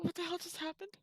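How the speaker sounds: high, hushed disbelief; stunned and incredulous; baffled. Acted. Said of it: "cock my head towards Koros like".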